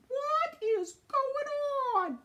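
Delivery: high, slow, astonished squeak; startled moon. Acted. Moon sees arrow coming, high astonished squeak. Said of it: "And he's going".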